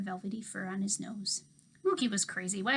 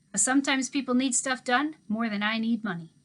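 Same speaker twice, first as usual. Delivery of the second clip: put-on easygoing old handyman; 3 semitones higher, 6 dB louder than usual. A narrator's reading. Old handyman teasing the girl about her dog, louder and folksy.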